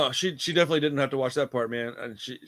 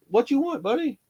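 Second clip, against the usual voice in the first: much higher doting dog-talk voice; pet talk; 11 semitones higher than usual. Real, not acted. Host turns to his dog mid-recording, high pet talk. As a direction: coo a question up high to a pet.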